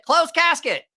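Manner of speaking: loud, very high catchphrase without the filter; high, gleeful-villain style shout in own voice; retold demon catchphrase. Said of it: "I played with that voice".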